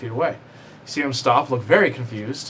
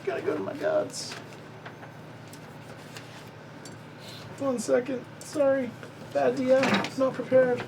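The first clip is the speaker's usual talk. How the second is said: flustered apology; 13 semitones higher, 5 dB quieter than usual. Real, not acted. DM flustered, searching notes.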